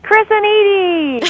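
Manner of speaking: loud, high, slow jingle cry; excited call-out. Acted. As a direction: belt out the show names like a jingle. Calling out show names.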